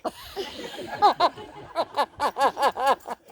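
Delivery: loud helpless laugh at the memory; real burst of laughter. Real, not acted. After telling the tea story.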